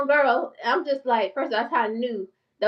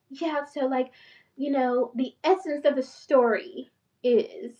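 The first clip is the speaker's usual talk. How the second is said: slowed, polished professional voice; proper eloquent speaker; 2 semitones higher, 3 dB quieter, slower than usual. Shown as a demonstration. Showing how a doctor could talk, in a proper polished voice. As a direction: put on a lightly formal, articulate tone. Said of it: "I could be like".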